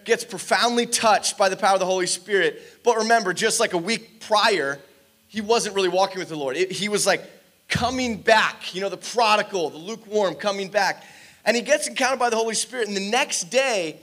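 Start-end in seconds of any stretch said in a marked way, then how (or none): none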